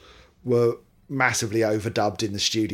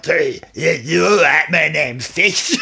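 slow, hammed-up Scottish-ish accent, higher and louder; 5 semitones higher, 8 dB louder, slower than usual. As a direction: drawl out a bad Scottish rock-singer greeting. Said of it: "What happened to his accent?".

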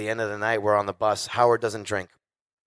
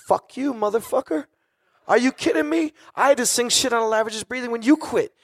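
high-pitched exasperated rant; mock indignation; 13 semitones higher than usual. Friend firing back at a compliment, high mock-indignant retort. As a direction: protest in disbelief, pitched way up.

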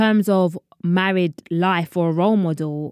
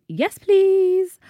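high, drawn-out American "yes, please"; sassy, high-pitched American flourish; American-accent catchphrase; 13 semitones higher than usual. Acted. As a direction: sing out a playful American catchphrase. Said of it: "there's a new phrase that I say".

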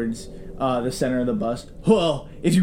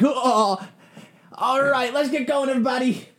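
Gary's voice; 10 semitones higher than usual. Acted. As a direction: sing out to the bus driver. GM voices Gary again.

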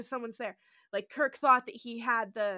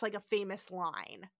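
usual speaking voice

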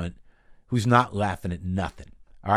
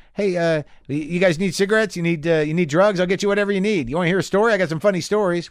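a raised, fawning sales pitch; eager-to-please inmate; 7 semitones higher, 4 dB louder than usual. Retold or imitated. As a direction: suck up to the tough guys. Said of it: "would you be the guy that's like".

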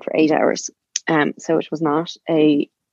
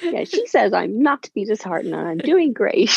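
higher-pitched, talking through laughter; amused, laughing; 5 semitones higher than usual. Real, not acted. Joking with listener about ignoring the midwife, laughing. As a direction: laugh along while playing up the defiance.

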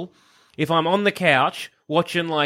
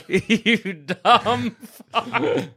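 slow laughing jeer; drawn-out giggling taunt; laughing insult; 8 semitones higher, much slower than usual. Real, not acted. Mocking friend's slip, laughing.

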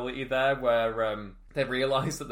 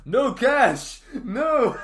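much higher, louder hammed-up anguished yell; anguished cry; 11 semitones higher, 9 dB louder, much slower than usual. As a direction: scream his partner's name in mock horror. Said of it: "where he's like".

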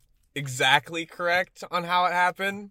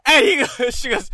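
ordinary delivery

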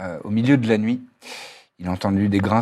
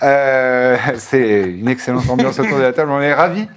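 upbeat host welcome, raised and louder; show intro; 4 semitones higher, 5 dB louder than usual. Acted. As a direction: open the show like a tavern host.

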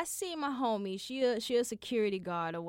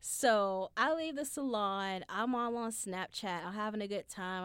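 normal talking voice

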